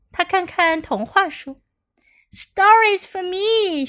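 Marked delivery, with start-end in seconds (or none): 2.3-3.9 high, slow and delighted; delighted child. Acted. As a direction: savour the joy of her own books. Clara delighted with books.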